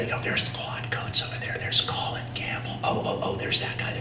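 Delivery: hushed, awed whisper naming heroes; starstruck whisper. Retold or imitated. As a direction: whisper it like you don't dare be heard. Starstruck at meeting idols.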